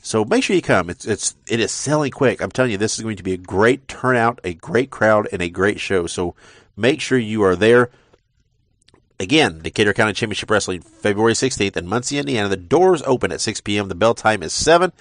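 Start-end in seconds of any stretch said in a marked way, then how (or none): none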